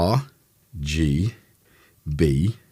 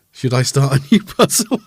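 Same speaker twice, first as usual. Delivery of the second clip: laughing; 13 semitones higher, 4 dB louder than usual. Real, not acted. Laughing at daughter interrupting the stream.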